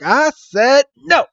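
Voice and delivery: high, slow insistent no; hurt Lapras, slow high refusal; firm refusal, high. Retold or imitated. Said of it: "Lapras is like".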